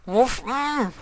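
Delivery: high, muffled through pressed lips; through squished lips. A narrator's reading. Nightmare Moon, cheeks squished by Sombra, muffled and high. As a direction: talk with cheeks squeezed. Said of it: "delicately inquired through compressed lips".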